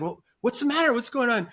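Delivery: high-pitched mock concern; retold questioning, pitched well up; imitated concerned brother. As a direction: play a brother anxiously asking a caller. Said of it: "my brother's like".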